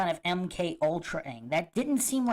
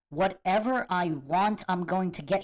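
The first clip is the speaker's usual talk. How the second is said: ordinary talk, nothing marked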